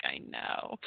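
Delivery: soft whispered sympathy; hushed. Retold or imitated. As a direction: breathe it like a sad secret. Quiet agreement at sad sight.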